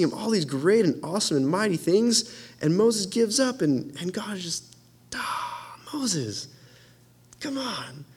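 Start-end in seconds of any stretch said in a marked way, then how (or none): 5.1-8.0 breathy, much softer disappointed plea; a disappointed God, barely above a whisper; soft exasperated sigh. Acted. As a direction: sigh it out, let down. God's disappointed sigh at Moses.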